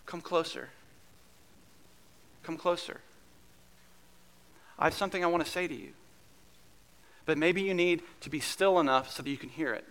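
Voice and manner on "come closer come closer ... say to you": softer, gentle inviting call; God calling his people closer, softly. Acted. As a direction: draw the listener near, hushed. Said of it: "God whispers to you and to me".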